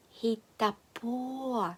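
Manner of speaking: "Хітапо" as one word, drawn out slowly. Shown as a demonstration. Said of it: "вимовляється як одне". («Хітапо» повільно, як одне слово)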